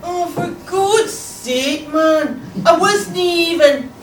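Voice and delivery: long, drawn-out protesting whine; outraged pupil. Acted. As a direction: drag the words out in wronged indignation.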